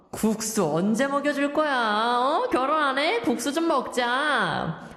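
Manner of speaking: hammed-up corny old-timer, pitched in lively swoops; old-fashioned 늘크크 teacher. Radio host playing 늘크크 reads lyrics aloud, corny old-fashioned teacher. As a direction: read it like a dated, corny-joking character. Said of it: "늘크크 선생님 joining us in the studio reading out".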